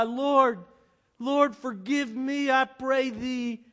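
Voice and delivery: loud, high, slow plea; bitter cry. Acted. Simon on his knees begs his Lord, bitter cry. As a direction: cry out for forgiveness in anguish. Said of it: "broke into a bitter cry".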